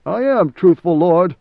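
insistent protest. Acted. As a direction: swear you tell the truth. Frightened servant protests.

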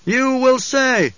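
very high, drawn-out pronouncement; powerful command voice. A narrator's reading. Paul agreeing while working on the crowd, commanding. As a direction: use the voice to sway them. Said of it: "used the powers of voice".

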